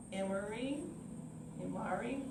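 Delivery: usual speaking voice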